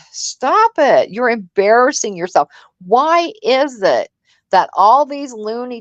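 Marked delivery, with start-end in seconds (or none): 0.1-1.1 slow, dismissive "stop it"; weary eye-roll scold; exasperated stop it. Acted. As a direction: drag it out, fed up and scolding. Mocking the ranting streamer.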